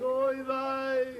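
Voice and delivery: high, loud call from afar; cry for help. Acted. Stranded skier calling down to the village, high loud cry. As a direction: holler it out across the mountains. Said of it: "he was crying for help".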